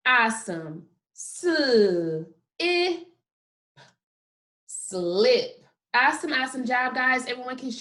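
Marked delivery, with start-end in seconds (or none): 1.2-3.0 sl, then i, well apart; sound by sound. Shown as a demonstration. Teacher answering what sounds you hear, one by one. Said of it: "What sounds do you hear?". 4.7-5.6 blended back together. Shown as a demonstration. Teacher putting sounds together for the class, blended. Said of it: "What sounds do you hear?".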